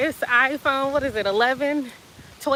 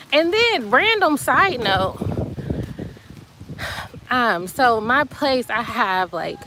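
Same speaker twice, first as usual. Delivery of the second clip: ordinary talk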